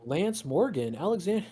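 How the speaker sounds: lightly voiced bro pleading with his friend; friend's voice. Friend at the computer desk urges Tyler back to games, lightly voiced. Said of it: "your friend looks at you and goes".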